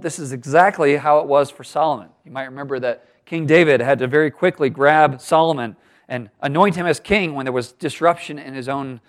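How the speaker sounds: ordinary talk, nothing marked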